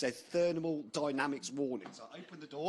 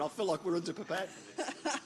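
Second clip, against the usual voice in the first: laughing; 5 semitones higher than usual. Real, not acted. Laughing at the short sleeves.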